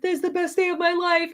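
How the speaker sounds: high, giddy imitation of an overjoyed fan; thrilled fan. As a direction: play the guy beside you who is over the moon. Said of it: "he turned to me and he was like".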